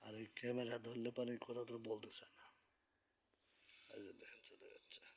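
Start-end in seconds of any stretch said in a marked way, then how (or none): 2.2-5.0 hushed whisper so the neighbours keep sleeping. Real, not acted. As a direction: breathe it out without voice, late at night. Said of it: "এই জন্য আস্তে কথা বলতেছি।".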